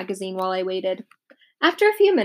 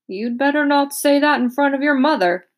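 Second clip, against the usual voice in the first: dentist voice; 5 semitones higher than usual. A narrator's reading. Dentist joking with Peter.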